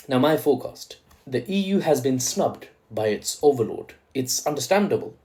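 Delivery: normal talking voice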